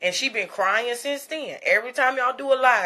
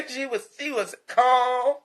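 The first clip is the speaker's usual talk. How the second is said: slow calm; slower than usual. Acted. Dreamy description of calm baby.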